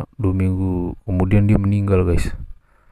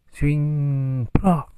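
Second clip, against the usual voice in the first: sound effect; 8 semitones higher than usual. Retold or imitated. Sound of tip-ex hitting fan.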